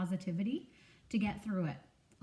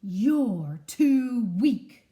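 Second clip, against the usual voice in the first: very slow, loud, drawn-out sneer; haughty eagle dismissing the small squirrel; scornful eagle; 3 semitones higher, 11 dB louder, much slower than usual. Acted. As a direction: look down your beak at him. Eagle mocks Jules.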